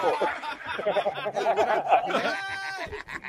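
roaring laugh; big shouted laugh at the joke; laughing out loud; loud burst of laughter. Real, not acted.

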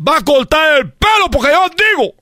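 high, emphatic parental order; stern father. Acted. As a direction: lay down the law as dad. Father ordering his reluctant son. (padre mandón, tono tajante y agudo)

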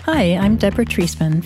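ordinary talk, nothing marked

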